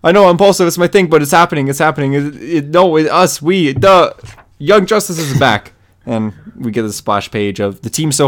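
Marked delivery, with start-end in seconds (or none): 0.0-5.7 excited, high and louder mock freak-out. Bart freaking out to teammates that the team is back, excitedly. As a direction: imitate an overexcited kid hero gushing. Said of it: "Bart starts freaking out".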